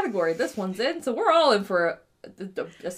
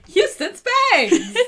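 announcer voice; 11 semitones higher, 9 dB louder, much slower than usual. Shown as a demonstration. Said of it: "With her husband".

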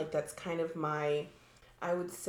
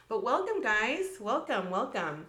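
usual speaking voice

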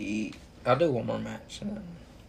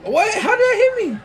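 shocked laughing shriek; very high, loud disbelief with laugh; raging gamer; 11 dB louder, faster than usual. Real, not acted. Hit unexpectedly in game.

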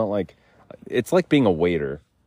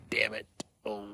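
breathy whisper, frustrated; whispered damn it; 2 semitones higher, 10 dB quieter than usual. Real, not acted. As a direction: whisper it in defeat. Muttered under breath.

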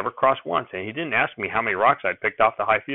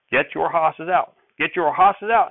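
louder order; 6 semitones higher, 4 dB louder, slower than usual. Acted. Grandfather orders boy to work.

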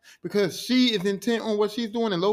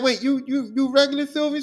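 higher, louder startled question; surprised Loki; 8 semitones higher, 4 dB louder than usual. Acted. Loki to Sylvie at McDonald's, higher startled surprise. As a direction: caught off guard, disbelieving.

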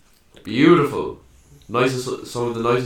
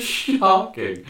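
mock outrage; 11 semitones higher, 4 dB louder than usual. Real, not acted.

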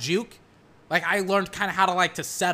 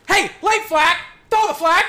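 much higher, loud fan yell; yelling for a flag; 11 semitones higher, 8 dB louder than usual. Real, not acted. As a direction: holler at the refs.

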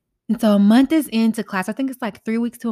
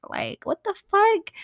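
much higher squealing what the fuck; mock outrage; 12 semitones higher than usual. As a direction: mimic a scandalized friend, pitched way up. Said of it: "I know a lot of y'all like".

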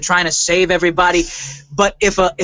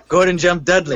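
caveman voice; 3 semitones lower than usual. Pretend. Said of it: "if I may evoke a little caveman".

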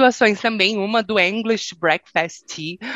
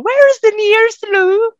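much higher posh question; British lady; 14 semitones higher, 5 dB louder than usual. Retold or imitated. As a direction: mimic a British woman asking. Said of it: "mulheres e perguntavam". (imitando britânica, bem aguda)

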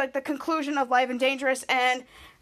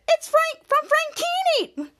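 high, slow squeal, imitating Charlotte; mock girlish squeal of surprise; a teen girl gasping at a famous face; excited girl voice; 13 semitones higher, slower than usual. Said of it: "Charlotte said".

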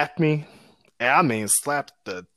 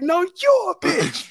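mock comeback; 3 dB louder than usual. Retorting insult back.

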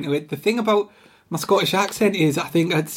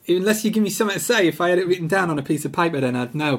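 usual speaking voice

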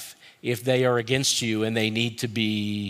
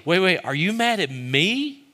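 high-pitched acted interruption; taken aback; 8 semitones higher, 4 dB louder than usual. Listener stopping a worked-up friend, acted as taken aback. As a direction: break in with an alarmed rising question. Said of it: "they maybe stop you and say".

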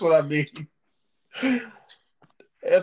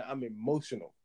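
mock sappy quote; 9 dB quieter than usual.

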